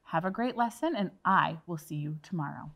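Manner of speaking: ordinary talk, nothing marked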